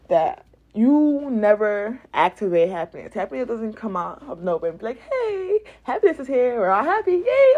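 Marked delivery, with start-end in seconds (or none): 5.0-7.5 high, gleeful announcement rising to a cheer; hammed-up party cheer; mock cheerful. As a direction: burst in like happiness personified. Acts out happiness suddenly arriving.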